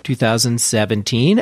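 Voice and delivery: usual speaking voice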